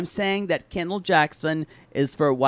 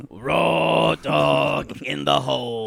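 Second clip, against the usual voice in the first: slow dramatic drawl; much slower than usual. Acted. Mock dramatic catchphrase.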